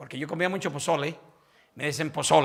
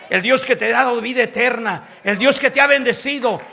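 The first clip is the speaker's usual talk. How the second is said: building preaching; 6 semitones higher, 13 dB louder than usual. Acted. Pastor praising God before the congregation, building to a shout. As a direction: pile each phrase higher until the cry.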